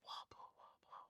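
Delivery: whispered wobble, wobble; whispered parting taunt. Retold or imitated. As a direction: breathe it through the window.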